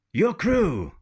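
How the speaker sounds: pirate captain questioning the women; pirate asking again. Acted. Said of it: "asked once again, but this time in English".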